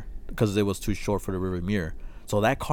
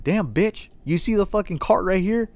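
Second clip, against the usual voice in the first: much higher, indignant mock rant; annoyed rant; 12 semitones higher, 3 dB louder than usual. Fuming at the security guard who ignored them, high indignant rant. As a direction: vent at her like she is useless.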